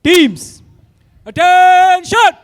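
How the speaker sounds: long, drawn-out shouted command; drawn out parade commands. Real, not acted. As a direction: bark it across the parade ground.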